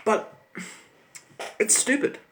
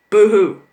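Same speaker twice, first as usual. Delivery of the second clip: loud, higher sneering "boo-hoo"; mock boo-hoo; 5 semitones higher, 12 dB louder than usual. Sneering at protesters, loud mock sob. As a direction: jeer it like fake crying. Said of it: "mocking the protesters".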